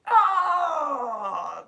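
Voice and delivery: hammed-up cry as the sword is forced away; mock disarmed yell. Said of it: "just has to go".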